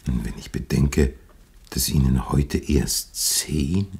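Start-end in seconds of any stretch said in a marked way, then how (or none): none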